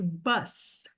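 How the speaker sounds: usual speaking voice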